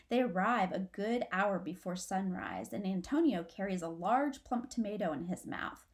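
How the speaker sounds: ordinary delivery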